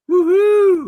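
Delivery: high, loud woohoo; excited cheer. Real, not acted. Cheering chat news.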